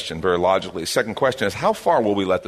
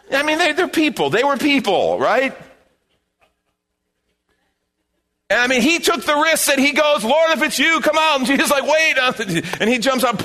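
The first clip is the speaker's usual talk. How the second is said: high, loud preaching build-up; full-force preaching; 4 dB louder than usual. Real, not acted. Pastor preaching to the congregation, high and loud. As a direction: preach it out, lifting the whole room.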